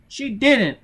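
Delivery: put-on high, loud child's cry; frightened child. A narrator's reading. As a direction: squeal it like a scared little girl. Child answers under pressure.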